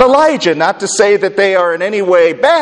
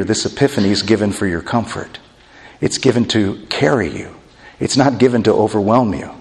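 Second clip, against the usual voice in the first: soft, low, comforting voice; calm frightened friends quietly, as an imagined Jesus; gentle reassurance; 6 semitones lower, 4 dB quieter than usual. Retold or imitated. Jesus comforting his overwhelmed disciples after touching them, gentle reassurance.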